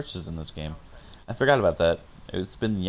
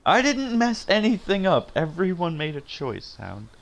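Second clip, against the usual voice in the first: high-pitched, raised protest; defensive protest; 8 semitones higher, 3 dB louder than usual. Real, not acted. Streamer defends her choices to Hound, protesting high. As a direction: protest your innocence, pitch shooting up.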